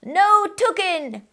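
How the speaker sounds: high, slow crew chant; crew cry. Acted. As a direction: echo the captain mockingly. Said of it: "the crew cried".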